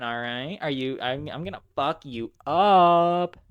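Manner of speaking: a hammed-up evil laugh and threat; unhinged villain. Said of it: "like the Joker".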